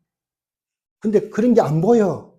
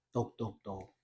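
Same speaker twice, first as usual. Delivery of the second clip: very soft, low mock knocks; gentle knocking; 8 semitones lower, 19 dB quieter, slower than usual. Jesus knocking at the heart's door, softly imitated knocking. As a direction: drop right down and tap out the words.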